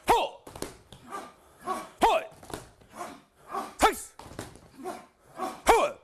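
high, sharp martial arts shouts; kiai shouts. Real, not acted. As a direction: bark each strike out. Kung fu kiai shouts during form.

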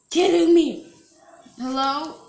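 ordinary delivery

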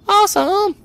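high excited squeal; genuinely thrilled cheer. Real, not acted. Excited about a thousand credits.

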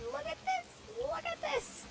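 extremely high, squeaky exclamation; amazed squeal. Real, not acted. Gamer amazed by a bridge, squealing. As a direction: squeal it twice in disbelief.

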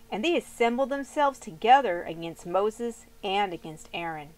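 ordinary talk, nothing marked

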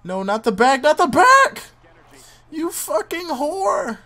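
raging, high and loud at the game; angry yelling. Real, not acted. Gamer yelling at the wrestler on screen, furious shout. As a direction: scream it out in real fury. Player yelling at opponent's attack.

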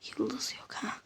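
hushed disappointed whisper. Real, not acted. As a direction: whisper it under breath. Whispered search.

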